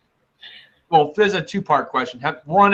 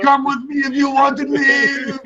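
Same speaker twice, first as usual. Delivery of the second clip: Arnold impression; 7 semitones higher than usual. Quoting Arnold movie line.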